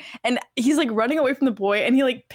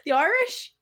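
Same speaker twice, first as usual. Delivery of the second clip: higher-pitched mock Irish accent; 4 semitones higher than usual. Teasing co-hosts after being told it's not Irish, mock Irish accent. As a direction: put on a playful Irish lilt.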